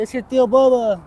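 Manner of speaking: put-on baby talk. Uncle introducing himself to the baby, cooing high. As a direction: coo it to a little one. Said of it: "He's like".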